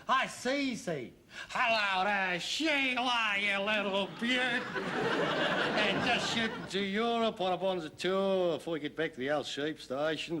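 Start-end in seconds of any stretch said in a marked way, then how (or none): 1.5-10.4 broad put-on Australian; Australian accent. Son showing father how to pass as Australian, mock Australian accent. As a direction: do a comic Aussie tourist accent. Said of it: "you can do an Australian accent".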